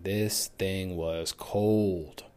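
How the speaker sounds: slow, drawn-out stress on "cold"; emphatic COLD. Real, not acted. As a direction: stretch out "this thing was cold" word by word. Insisting on how cold it was.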